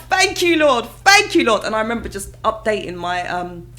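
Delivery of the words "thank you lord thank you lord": high, loud jubilant praise; joyful thanks. Retold or imitated. Thanking the Lord after the news, high joyful voice. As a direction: cry out thanks to God. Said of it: "I was like".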